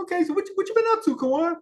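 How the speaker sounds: high, bright-eyed mock friend asking; high friendly question. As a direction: pitch it way up like an eager old friend. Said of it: "now that we started conversation".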